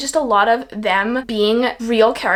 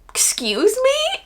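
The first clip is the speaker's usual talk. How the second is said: much higher, slow and stunned; shocked excuse me; 12 semitones higher, much slower than usual. Retold or imitated. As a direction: drag it out in disbelief, way up high. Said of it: "And she's like".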